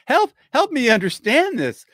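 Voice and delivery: high, loud, helpless plea; begging for it to make sense; flustered cry for help; overwhelmed. Real, not acted. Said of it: "I'm choking on that number".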